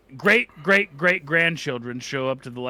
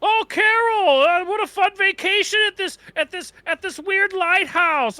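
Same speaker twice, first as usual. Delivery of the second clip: Jordan Peterson; 5 dB louder than usual. Pretend. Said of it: "I turned into Jordan Peterson".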